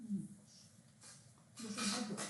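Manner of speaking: normal talking voice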